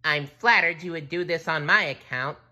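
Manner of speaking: high, loud cartoon voice; high-pitched cartoon hero; SpongeBob voice. A narrator's reading. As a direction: pipe up as an eager cartoon underling. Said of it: "said SpongeBob".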